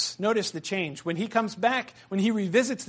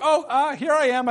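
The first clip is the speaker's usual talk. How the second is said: Bilbo; 8 semitones higher, 4 dB louder than usual. Retold or imitated. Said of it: "and he's like".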